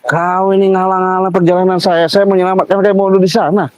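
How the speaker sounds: high-pitched indignant complaint; heated protest. Real, not acted. Driver on a rescue protesting the blockage, high heated complaint. Arguing with the man blocking him.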